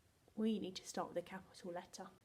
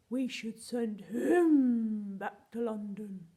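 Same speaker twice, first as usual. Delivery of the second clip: loud silly voice stretching HIM; funny voice; 11 dB louder, much slower than usual. Pretend. Teacher invites pupils to copy, loud drawn-out silly voice. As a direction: draw out HIM long and loud, slowly. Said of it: "Try and say it in a funny voice".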